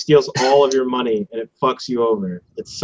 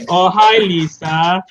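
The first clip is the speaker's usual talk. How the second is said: higher and louder, hammed-up Oh hi Lisa; mock Wiseau greeting; Tommy Wiseau impression; 6 semitones higher, 4 dB louder, much slower than usual. Quoting the famous movie greeting, mock Wiseau voice. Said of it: "You sound like Tommy Wiseau".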